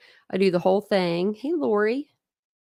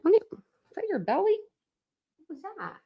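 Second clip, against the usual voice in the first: much higher, quick pet-talk voice; 11 semitones higher, 3 dB quieter, faster than usual. Real, not acted. Streamer turning to her dog making a noise, baby talk.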